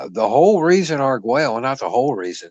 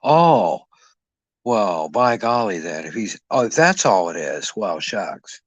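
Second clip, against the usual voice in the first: fake cowboy accent; 2 semitones lower than usual. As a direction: play the gullible country rube. Mock hick reaction to euphemism.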